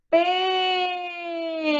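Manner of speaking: PEIXE called out with a long, high PEI; sing-out "Peeei-xe", stressed syllable held; calling out the word. Shown as a demonstration. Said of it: "Chamar a palavra peixe".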